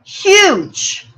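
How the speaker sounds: a sudden high break on huge; the word catches as tears rise; holding back tears. Real, not acted. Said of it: "I'm holding back the tears right now".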